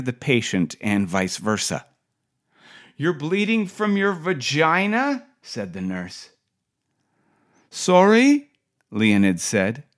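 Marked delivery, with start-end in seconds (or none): 3.0-5.2 pitched way up, a touch disbelieving; high incredulous nurse. Retold or imitated. Nurse mishears through partition. 7.7-8.5 shout-asked way up high, straining to hear; patient straining to hear nurse through glass, loud high sorry. Retold or imitated.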